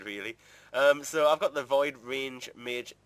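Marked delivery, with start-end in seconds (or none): none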